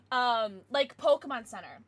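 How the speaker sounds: ordinary talk, nothing marked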